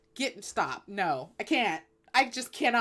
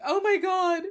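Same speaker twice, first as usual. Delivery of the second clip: mock crying; 3 semitones higher than usual. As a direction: blubber like a wounded president, easing off.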